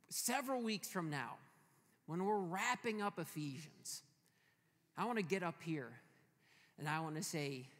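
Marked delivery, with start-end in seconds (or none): none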